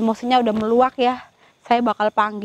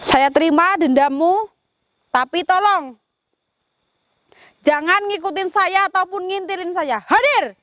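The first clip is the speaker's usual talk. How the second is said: summoning call; 6 semitones higher than usual. Real, not acted. Summons the spirit to appear.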